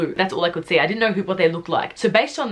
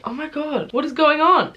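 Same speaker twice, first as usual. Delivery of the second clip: stressed exclamation; 8 semitones higher than usual. Real, not acted. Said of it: "Am I that stressed about this story?".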